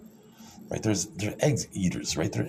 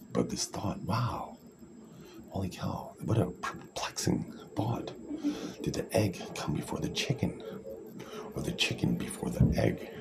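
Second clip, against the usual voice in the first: hushed mock pondering; 8 dB quieter than usual. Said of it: "you're like".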